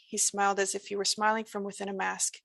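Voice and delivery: ordinary delivery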